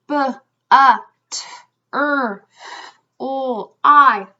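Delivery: each sound of butterfly separately, from B to I; short isolated speech sounds with gaps, not letter names. Shown as a demonstration. Teacher showing how many sounds to remember, sound by sound. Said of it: "all the way through all those sounds".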